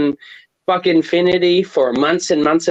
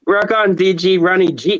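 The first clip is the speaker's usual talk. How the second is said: ordinary talk, nothing marked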